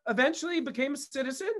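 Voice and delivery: ordinary talk, nothing marked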